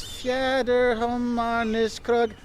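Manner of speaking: "Fjäderhommarniskrog" read very slowly, syllable by syllable, in a foreign-sounding voice; sounding out a Swedish sign. Acted. Tourist reading a Swedish sign aloud to viewers, slow and unsure. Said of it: "Like this over here says".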